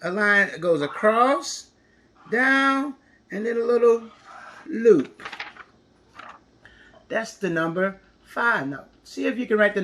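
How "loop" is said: ordinary talk, nothing marked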